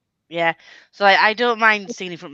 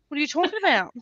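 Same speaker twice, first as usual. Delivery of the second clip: higher mock-Australian denial; badly faked Australian, pitched up; put-on Aussie accent; 7 semitones higher, faster than usual. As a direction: deny it in a bad Aussie accent. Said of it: "You still doing the Aussie accent?".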